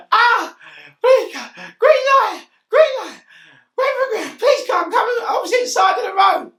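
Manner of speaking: high, loud, wailing plea, laughing through it; mock injured pleading. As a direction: whimper and beg like you're hurt on the road. Injured man pleading with police.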